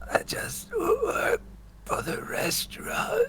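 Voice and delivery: strained, airless whisper from a chokehold; choked whisper. Pretend. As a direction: squeeze the words out while being choked. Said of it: "Thank you, Darth Vader".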